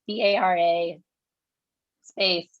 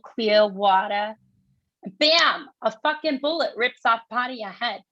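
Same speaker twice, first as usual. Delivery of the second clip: heated New York monologue, higher throughout; New York accent, indignant and higher; 3 semitones higher than usual. Acted. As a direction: build the story in a tough New York accent. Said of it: "So it's New York accent".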